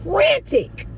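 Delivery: high, loud frantic cry. Retold or imitated. Retelling a resident's distressed phone call, frantic. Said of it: "a woman crying frantic".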